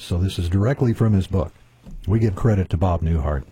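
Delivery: normal talking voice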